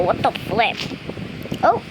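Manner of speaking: ordinary talk, nothing marked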